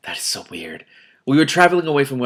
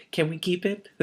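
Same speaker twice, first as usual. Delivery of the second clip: put-on higher, softer kid asking for a pet; mock child plea; 9 semitones higher, 4 dB quieter than usual. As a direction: beg like a little kid.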